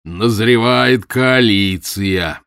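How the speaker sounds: a booming, very slow loud announcement; громогласно. A narrator's reading. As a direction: proclaim it grandly. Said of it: "громогласно объявил он". (профессор громогласно объявляет)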